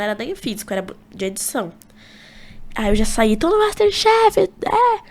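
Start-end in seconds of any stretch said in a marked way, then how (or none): 3.4-5.0 high, louder drawn-out cheer; retold squeal of joy, stretched out; excited cheer. Boy reliving news of getting into MasterChef, excited cheer.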